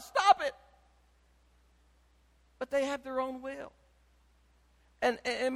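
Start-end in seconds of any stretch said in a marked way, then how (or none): 0.0-0.5 high, louder mock scold; exasperated parent. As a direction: scold a grown child in frustration. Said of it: "trying to tell the child".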